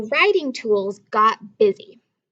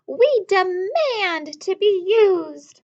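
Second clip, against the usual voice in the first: higher, slow, insistent voice; a little crayon making demands; demanding crayons; 7 semitones higher, 4 dB louder, slower than usual. A narrator's reading. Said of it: "asked the crayons".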